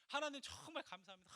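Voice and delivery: high, acted burst of gratitude; retold joyful thanks. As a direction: act out thanking God with delight. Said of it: "그렇게 기뻐요". (기뻐서 감사하는 흉내)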